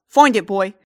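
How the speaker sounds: loud gruff call to a dog; shout. Acted. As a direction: bark the order to the hound. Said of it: "the guy kinda shouts out".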